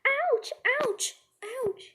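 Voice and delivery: high, loud mock ouches; acted pain cry. Storyteller faking an injury in class, acted pain cry. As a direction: yelp as if hurt, hammed-up. Storyteller fakes being hurt.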